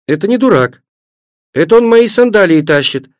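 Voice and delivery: put-on high, excited little-girl voice; joyful cry. A narrator's reading. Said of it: "радостно закричала Светлана". (радостный детский возглас)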